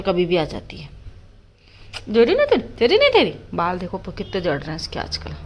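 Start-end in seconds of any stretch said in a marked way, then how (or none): 2.1-3.3 playful tug. Real, not acted. Tugging with the dog.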